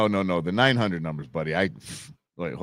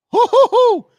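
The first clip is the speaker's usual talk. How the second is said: high, loud hoots of delight; loud gleeful hoo hoo hoo; excited hooting; 12 dB louder than usual. Real, not acted. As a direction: whoop it up in excitement. Excited reaction to prank call.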